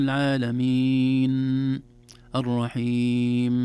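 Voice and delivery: "العالمين الرحيم" with the last ن and م held too long; slow, loud recitation, leaning on each final hum; overlong final nasal. Shown as a demonstration. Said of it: "نضغط على حرف النون زمن طويل". ("العالمين الرحيم" بإطالة الغنة)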